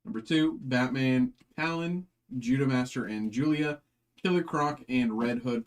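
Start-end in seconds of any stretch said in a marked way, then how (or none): none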